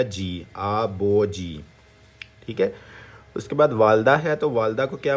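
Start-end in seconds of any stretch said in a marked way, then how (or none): 0.0-1.6 आ बो जी blended slowly, syllable by syllable. Shown as a demonstration. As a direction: draw out आ बो जी. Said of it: "तो ये क्या बन जाएगा".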